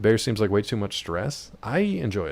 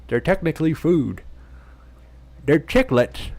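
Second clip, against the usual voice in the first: high, gummy voice with no teeth; toothless voice; 6 semitones higher, 7 dB louder than usual. Pretend. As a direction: talk as if your teeth are out. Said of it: "I'm talking like I have no teeth".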